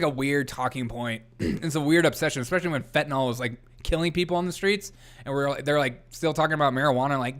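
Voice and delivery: ordinary talk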